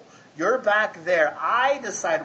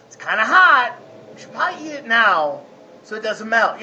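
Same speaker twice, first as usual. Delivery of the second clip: put-on high, louder sly kid voice; scheming child; 3 semitones higher, 6 dB louder than usual. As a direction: make the case like a little lawyer. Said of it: "He'll say something like".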